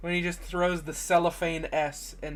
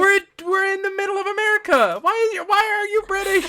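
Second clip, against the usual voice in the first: high, loud comic disbelief; mock outrage; 6 dB louder than usual. Questioning a British kid in middle America, mock outrage. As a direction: exclaim it in exaggerated bafflement.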